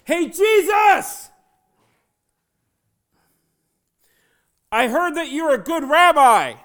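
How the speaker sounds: high, drawn-out yell to get attention; full-throated shout. Acted. Blind man calling out to Jesus, shouting at full force. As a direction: holler it slowly across a crowd. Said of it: "this is what the blind man did".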